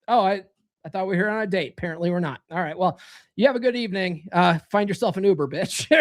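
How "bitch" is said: tacked-on insult. Pretend. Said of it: "You don't have to add the bitch on that".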